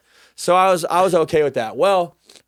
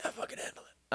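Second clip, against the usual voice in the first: put-on drunken whisper; 18 dB quieter than usual. Drunk dad mumbling reply.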